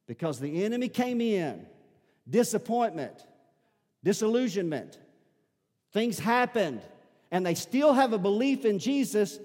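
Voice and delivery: loud, high, driving sermon delivery; high-pitched pulpit proclamation at full volume; full-force preaching. Acted. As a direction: preach it out hard to the whole congregation.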